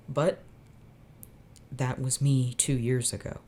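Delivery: ordinary delivery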